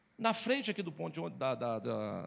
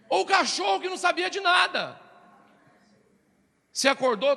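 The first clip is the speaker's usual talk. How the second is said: shouted high and loud from the pulpit; preaching shout; 12 semitones higher, 11 dB louder than usual. Real, not acted. Preacher shouting to the church about the dog. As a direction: belt it out to the congregation.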